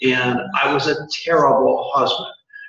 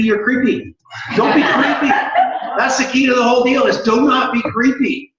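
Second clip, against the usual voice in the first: laughing; 9 semitones higher than usual. Real, not acted. Jokey warning to audience, laughing.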